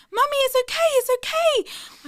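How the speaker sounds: put-on high, loud child's voice reassuring; comforting little boy. Little son calming his hysterical mother, high child's voice. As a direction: play a small boy soothing his mum. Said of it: "So he's like".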